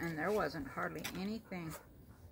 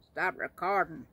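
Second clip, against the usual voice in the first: loud, barked command to the camera, the last stop recording slower; mock shouted order; 3 semitones higher, 16 dB louder, slower than usual. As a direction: shout it at the GoPro like a fed-up husband. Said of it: "He'd say".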